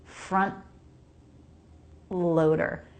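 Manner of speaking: "front loader" split by a long stop of air at the T; stopped T. Shown as a demonstration. Teacher showing the stop, "front loader" broken at an unreleased T. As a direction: halt the air after "front", then say "loader". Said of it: "Stop the air in between".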